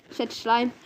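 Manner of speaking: ordinary delivery, nothing marked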